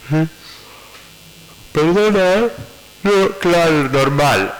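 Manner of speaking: high, offhand and careless, half listening; doctor not really listening to a patient's mother, caught off guard and dismissive; distracted and dismissive. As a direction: brush her off as if your mind is elsewhere. Acting distracted with grieving patient.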